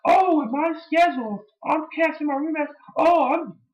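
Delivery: high sarcastic wrestler impression; sarcastic Punk mockery. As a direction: sneer it in a squeaky mock voice. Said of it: "And he's like".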